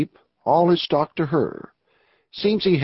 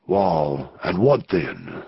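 a much lower, slow western outlaw; low outlaw drawl; 9 semitones lower, much slower than usual. Acted. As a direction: drop low for the rough outlaw Anson. Outlaw Snake Anson drawls reply.

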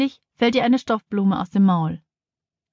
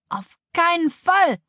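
high, drawn-out horrified no; emphatic refusal; 10 semitones higher, slower than usual. A narrator's reading. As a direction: forbid it slowly, pitch jumping up. Teacher forbids boy to climb. (entsetzte, gedehnte Ablehnung)

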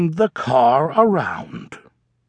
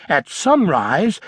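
ordinary delivery

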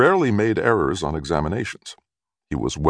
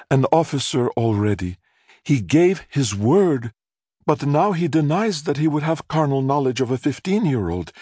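higher, theatrical weepy lament; tearful mother; 6 semitones higher than usual. A narrator's reading. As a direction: pour out her sad tale like a wronged heroine. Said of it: "Mother burst into tears".

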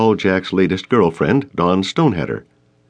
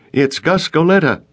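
raised, higher call through a closed door; 10 semitones higher, 3 dB louder than usual. Acted. Gus calling to the super through a closed door. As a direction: call it out so he hears it inside. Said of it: "Gus called through the closed door".

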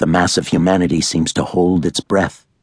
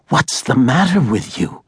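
mother's voice; 9 semitones higher than usual. A narrator's reading.

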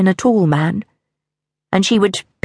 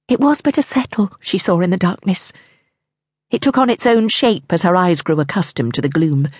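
usual speaking voice

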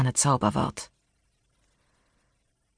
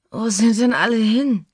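put-on high, slurring drunk question; slurred drunk; 7 semitones higher, 3 dB louder than usual. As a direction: babble it, drunk and lost. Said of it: "lallte sie". (lallend und betrunken fragend)

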